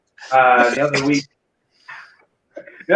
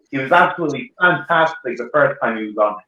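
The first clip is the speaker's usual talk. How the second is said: ordinary talk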